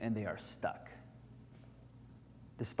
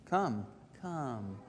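hushed, gentle beckoning; soft inflection; 7 dB quieter than usual. Shown as a demonstration. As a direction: try the word as a quiet beckoning. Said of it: "inflection of his voice there".

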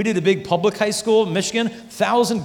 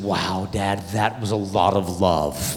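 tender daughter; 9 semitones lower, slower than usual. Retold or imitated. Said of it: "And she said".

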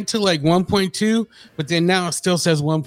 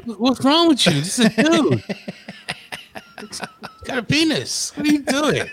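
mock scolding; 9 semitones higher than usual. Imitating Robin scolding him.

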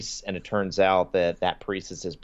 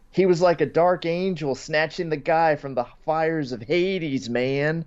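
imitated stoner, pitched higher; higher-pitched mock stoner storytelling; 6 semitones higher than usual. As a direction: marvel like an awed stoner. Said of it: "He says".

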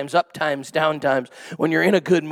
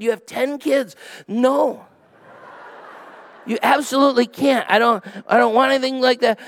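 higher-pitched comic protest; mock-appalled refusal; 5 semitones higher than usual. Host asked to take in ten kids, comic appalled refusal. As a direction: turn them down in mock alarm. Retold refusal of house guests.

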